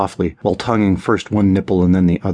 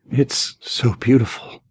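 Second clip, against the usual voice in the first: whimpering; 4 semitones higher, slower than usual. Acted. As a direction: breathe it out in awe, almost trembling. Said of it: "Paul whimpered".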